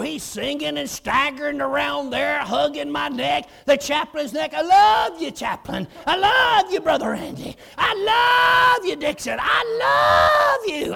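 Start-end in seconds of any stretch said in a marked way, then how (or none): 4.5-9.4 put-on loud, sloppy drunken declarations of love; retold joyful drunk man; drunk in the spirit. Drunk convert hugging everyone. 9.4-10.9 drawn out very high and loud, slow; slow, high drunken cry of I love you; drunk in the spirit. Retold or imitated. Drunk convert hugging everyone.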